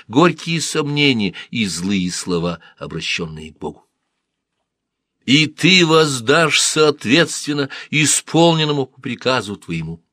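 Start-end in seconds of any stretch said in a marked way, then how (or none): 5.3-10.0 proud reminder. Acted. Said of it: "напомнила она ему".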